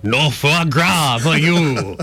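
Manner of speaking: slow, laughing snooty French; hammed-up French chef refusal; mock French accent. Mocking French, Soup Nazi style.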